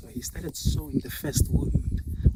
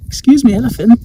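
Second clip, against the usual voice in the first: loud, high-pitched protest from a rhino; indignant rhino; 8 semitones higher, 14 dB louder than usual. Acted. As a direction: call out sharply, offended by a friend. Rhino protests to elephant in story.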